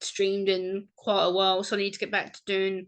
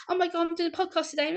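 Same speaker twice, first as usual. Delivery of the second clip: high, fast excited gush; a higher, faster happy rush; hyper excitement; 8 semitones higher, faster than usual. Retold or imitated. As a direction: blurt it like you can't contain the news. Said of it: "like, hyper and happy".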